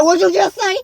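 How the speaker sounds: high, loud affronted question; indignant disbelief. Retold or imitated. Kid confronting yellers at the back of the bus, indignant. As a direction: pitch shoots up, incredulous.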